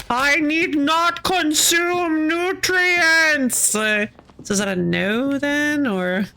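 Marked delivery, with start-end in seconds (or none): 0.1-3.7 high, slow, solemn declaration; lofty alien voice. Retold or imitated. Visitor declining food, high slow lofty tone. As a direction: speak like a being above eating, slowly.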